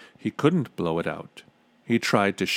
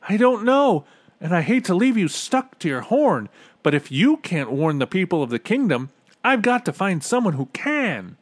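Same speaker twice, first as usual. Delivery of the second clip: put-on high, loud boy's voice; raised, high character voice; urgent Bartholomew; 9 semitones higher, 5 dB louder than usual. A narrator's reading. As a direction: speak up in urgent dismay. Bartholomew answers in crisis.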